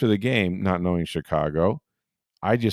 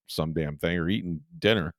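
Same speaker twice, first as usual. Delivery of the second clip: ordinary delivery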